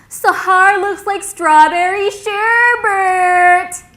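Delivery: high, drawn-out shout; excited holler. Retold or imitated. Logan running up to hug his friend, excited holler. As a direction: holler it out with every word stretched. Friend runs up, exclaiming in delight.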